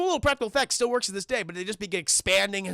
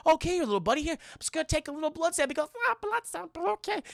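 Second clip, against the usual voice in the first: high-pitched talk to a little pet; acted coaxing; 7 semitones higher, faster than usual. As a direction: coax a small creature, pitched way up. Teacher coaxes creature before jab.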